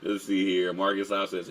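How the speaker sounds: usual speaking voice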